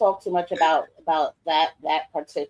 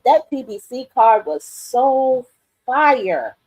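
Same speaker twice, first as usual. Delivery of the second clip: excited; 6 semitones higher, 6 dB louder, much slower than usual. Real, not acted. Excited praise of fight card.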